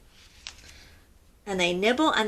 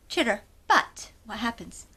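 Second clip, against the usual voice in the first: ordinary delivery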